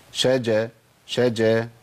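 شجا with a clear sh before the j; correct shin. Shown as a demonstration. Teacher modelling the right way, clear shin in شجا. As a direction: sound a true sh at the start of شجا. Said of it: "يبقى أقول إيه؟".

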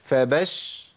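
فبش ending on a vowelless sh; still shin. Shown as a demonstration. Teacher splitting the doubled letter, still shin in فبش. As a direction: stop on the bare sh of فبش. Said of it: "أدي شين ساكنة".

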